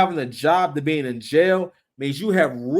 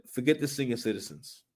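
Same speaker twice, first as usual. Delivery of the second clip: quiet quote; 4 semitones lower, 10 dB quieter than usual. Retold or imitated. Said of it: "He said".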